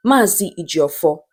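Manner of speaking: ordinary delivery, nothing marked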